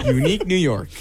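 laughing, over-crisp diction drill; prim precise elocution. Acted. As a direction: enunciate like a TV anchor, giggling. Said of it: "Anchorman style warm-ups".

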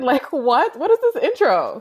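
amused, stunned reaction; flabbergasted. Real, not acted. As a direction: sound floored by the intro. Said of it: "I'm flabbergasted".

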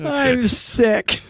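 wheezing with laughter; wheezy laugh. Real, not acted. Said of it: "She's wheezing".

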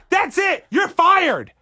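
high, laughing mock-stern kid; bossy kid firing. Girl firing the dad from her stand, high bossy voice. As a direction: fire him like a tiny boss.

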